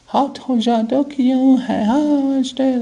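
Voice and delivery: high falsetto female impersonation; imitating women's talk. Shown as a demonstration. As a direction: put on a very high woman's voice. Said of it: "like women talk".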